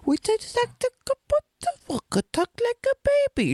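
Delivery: very high, babyish imitation voice; goofy baby-talk affect. Mocking the TV Viking's goofy voice, high baby talk. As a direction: squeak it out like an overgrown baby. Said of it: "goofy affect that he does".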